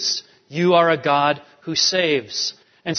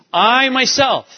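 "I" lifted high and loud; stress on I; 6 semitones higher, 4 dB louder than usual. Shown as a demonstration. Preacher explaining Anoki, stressing "I". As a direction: hit "I" hard in "I myself". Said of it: "He emphasizes the word "I".

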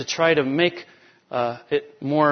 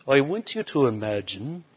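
imitating a British preacher, quieter; British accent; 5 dB quieter than usual. Camp speaker addressing his listeners, in a British accent. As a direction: put on a soft British accent. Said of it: "he said it like this, this British accent".

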